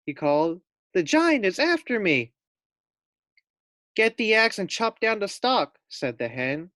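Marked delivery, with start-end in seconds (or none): 0.9-2.3 frightened call. Acted. Fluffy fleeing the giant. 3.9-5.8 urgent hen. Acted. Hen gives urgent advice.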